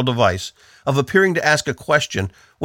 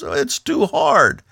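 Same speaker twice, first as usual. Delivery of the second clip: mock discouraged whine; 8 semitones higher, slower than usual. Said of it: "A person who's discouraged looks at the problems and says".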